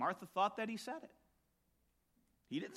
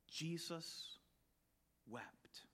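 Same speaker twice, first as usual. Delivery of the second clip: a hushed, reverent whisper; almost silent, solemn; 2 semitones lower, 12 dB quieter, much slower than usual. Acted. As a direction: breathe the word softly. Solemn reading of scripture.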